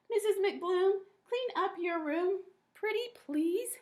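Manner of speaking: high, sweet pleading voice; fretting young teacher. A narrator's reading. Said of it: "Missus Brumble Sprout fretted".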